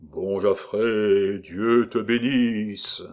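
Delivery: slow, hollow echoing chant; sinister echo. Acted. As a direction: echo it back ominously. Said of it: "comme un écho sinistre".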